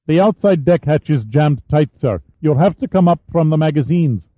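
put-on a teenage boy reporting up; adolescent voice. A narrator's reading. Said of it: "The voice had an adolescent pitch".

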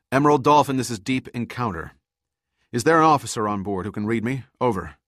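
loud, pushed call into a radio; radio call over storm. Acted. Pitt calls the burning ship over the radio, loud and urgent. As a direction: hail the other ship over howling wind.

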